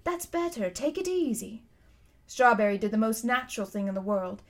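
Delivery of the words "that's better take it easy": soothing. A narrator's reading. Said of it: "said the cabby".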